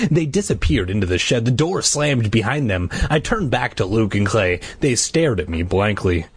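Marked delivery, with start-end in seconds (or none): none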